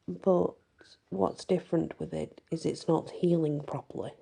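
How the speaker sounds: ordinary delivery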